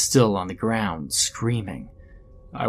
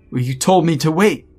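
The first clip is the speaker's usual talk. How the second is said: loud, higher-pitched retort; angry protest; 7 semitones higher, 6 dB louder than usual. A narrator's reading. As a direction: throw the blame back, raised voice. Janitor angrily confronts the professor.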